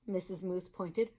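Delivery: ordinary delivery, nothing marked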